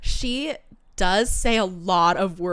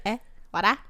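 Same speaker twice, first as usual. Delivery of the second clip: water in a mimicked Australian accent; teasing Australian-accent imitation; imitating Australian accent; faster than usual. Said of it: "It's 'cause you're like".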